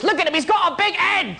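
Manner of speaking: put-on high-pitched jeering British kid; British child's voice. British kids mocking a caged man's big head, high childish jeer. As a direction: taunt like a ruthless British child. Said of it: "doing it in a British children's voice".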